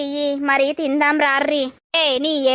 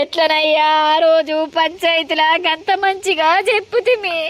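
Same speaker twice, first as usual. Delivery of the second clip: wailing; 5 semitones higher than usual. Acted. Mother wails over son.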